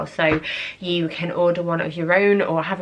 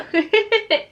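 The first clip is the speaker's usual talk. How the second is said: high giggle; 14 semitones higher, 4 dB louder than usual. Real, not acted. Graduation photo.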